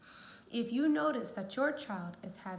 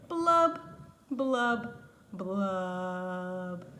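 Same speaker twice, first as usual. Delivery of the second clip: three blubs sinking lower each time; falling blubs; 4 semitones lower, 6 dB louder, much slower than usual. Acted. The pout pout fish ends his refrain with sinking blub sounds. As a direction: blow sad bubbles down the scale.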